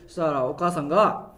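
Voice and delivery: ordinary talk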